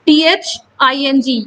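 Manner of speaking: slow letter spelling T-H-I-N-G; spelled out letter by letter. Shown as a demonstration. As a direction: spell टीएचआईएनजी out slowly, letter by letter. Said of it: "जैसे थिंग". (टीएचआईएनजी को धीरे-धीरे अक्षर-अक्षर बोलें)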